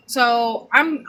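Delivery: usual speaking voice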